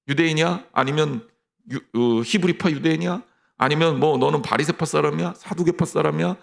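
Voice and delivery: ordinary delivery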